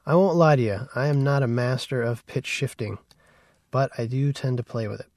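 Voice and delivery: ordinary delivery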